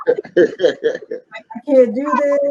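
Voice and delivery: high, slow, half-laughing tearful wail; tearful, breaking. Retold or imitated. Recruit in tears telling the sergeant she quits, slow and high. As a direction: stretch it out as if near tears. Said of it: "I'm, I'm in tears".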